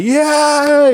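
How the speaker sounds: high, loud cheer; mock crowd cheer. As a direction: whoop like a fan in the stands. Audience cheering.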